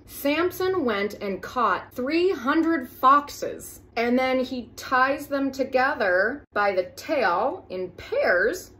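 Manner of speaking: slow, disbelieving storytelling; slow incredulous. Acted. Comedian baffled by the fox story, slow and disbelieving. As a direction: spell out each absurd detail slowly.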